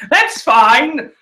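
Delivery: high, loud, strained insistence; feigned acceptance. Real, not acted. Fan denied a dream fight, loudly feigning acceptance. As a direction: insist loudly while clearly disappointed.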